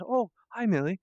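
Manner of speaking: high startled greeting; Randy. Acted. Character first notices Millie on the lawn, surprised. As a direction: say hello as if caught off guard. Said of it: "and he says".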